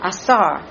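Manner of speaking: "Asar" with the weight on "sar"; "Asar" as a measured pronunciation demo; stress on SAR. Shown as a demonstration. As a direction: say "Asar" carefully, stressing the second syllable. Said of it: "emphasis on the S A R".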